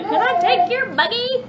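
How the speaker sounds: high, lightly voiced old lady asking. Mom imagining an old lady in the store, lightly voiced. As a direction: put on a little old lady's request. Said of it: "some old lady to sit there and say".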